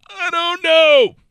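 high, slow squeaky aside; squeaky mock voice. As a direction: squeak it out mockingly. Mock aside about foldable phones.

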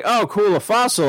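mildly excited, re-enacting a happy find; retold delight. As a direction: lift the pitch in pleased discovery. Retold delight at finding fossil.

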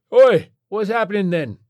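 loud, high British rocker yell; raucous old rock star calling out; aging British rock star. A narrator's reading. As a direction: holler like a washed-up British rocker. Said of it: "the rapidly aging British rock star".

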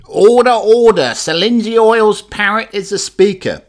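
very high, loud parrot squawk of the Speaker's call. Acted. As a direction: screech it like a parrot.

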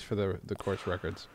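ordinary talk

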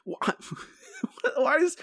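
breaking into a high laugh. Real, not acted. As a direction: laugh through the words, pitch jumping up. Laughing at the baffling accent.

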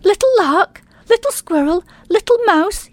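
high, excited calling out; excited shout. Acted. Little mole calling his friends, excited high shout. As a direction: call the friends over, thrilled. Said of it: "he shouted".

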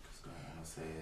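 hushed whisper. Real, not acted. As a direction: keep it under your breath. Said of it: "Why are you whispering?".

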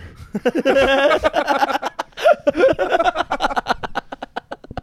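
long, high, loud laughing fit. Real, not acted. Guy laughing at his own wiener rhyme.

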